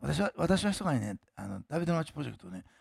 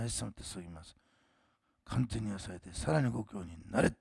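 healing prayer; 2 semitones lower than usual. Real, not acted. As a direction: pray for healing, commanding the sickness out.

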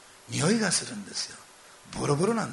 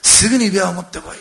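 much louder call to the servants; shouted order; 4 semitones higher, 12 dB louder than usual. Retold or imitated. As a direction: bellow the command across the house. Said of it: "大声叫んだんでしょうね". (雇い人に向かって大声で命じる父)

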